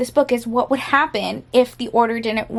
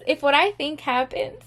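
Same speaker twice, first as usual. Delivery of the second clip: ordinary delivery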